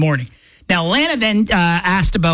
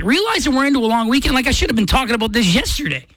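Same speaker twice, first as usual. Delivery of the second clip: ordinary delivery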